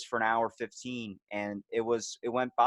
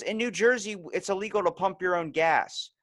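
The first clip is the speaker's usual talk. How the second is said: higher-pitched imitation of a woman; mimicked explanation; 9 semitones higher than usual. Jersey woman explaining the gas law, high light mimicry.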